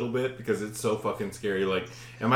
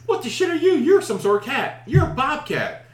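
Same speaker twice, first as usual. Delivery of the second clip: high, louder mock bewilderment; astonished; 11 semitones higher, 4 dB louder than usual. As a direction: exclaim at an unexpected animal, re-enacted. Acts astonishment addressed to the bobcat.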